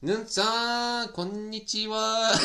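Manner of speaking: slow, high drawn-out hello; cheerful greeting. Real, not acted. Streamer welcoming viewer Nun to the stream, happy hello. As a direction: stretch the greeting out, pitched well up.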